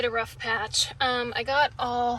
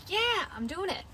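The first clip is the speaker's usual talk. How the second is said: mock confident cheer; 7 semitones higher than usual. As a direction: imitate yourself happily chugging along. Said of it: "you're just chugging along, you're like".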